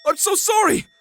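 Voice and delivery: loud, high pleading apology; desperate apology. Acted. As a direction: beg forgiveness at full voice. Fiancé begs mother's pardon.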